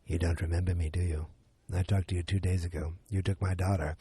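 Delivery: low, quiet menacing hero; quietly confronting a kidnapper; Liam Neeson. Retold or imitated. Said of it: "Liam Neeson looks right at him and he goes".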